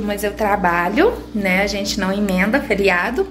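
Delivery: ordinary talk, nothing marked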